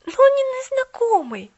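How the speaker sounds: high, loud objection; girl protesting hotly. A narrator's reading. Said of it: "возразила Полианна".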